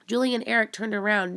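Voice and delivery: ordinary delivery, nothing marked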